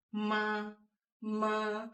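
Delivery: "ma" held high and level; high flat first tone. Shown as a demonstration. Teacher models first tone like ti, high sustained "ma". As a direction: sing "ma" on the scale's top note. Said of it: "really high and flat".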